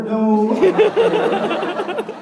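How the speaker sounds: laughing high and slow. Retold or imitated. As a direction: laugh through the denial. Defensive reply to classmate.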